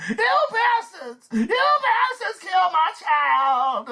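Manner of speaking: high, slow, grieving shout; wailing mother. Acted. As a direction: wail it at the killers.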